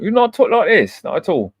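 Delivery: high-pitched mock British accent; put-on British accent. Streamer proving he can switch it up, high British accent. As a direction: slip playfully into a British accent. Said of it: "I switch it up pretty easily".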